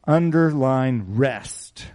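"Underline rest" drawn out very slowly, "Underline" stressed; slow it down and lean on "Underline"; deliberate, stretched-out command to mark "rest"; slow emphatic instruction. Shown as a demonstration. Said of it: "I want you to underline the word rest".

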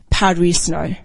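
ordinary talk

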